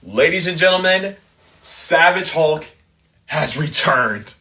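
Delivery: slow, grand showman reveal; announcer voice. Acted. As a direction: announce it like a ring host.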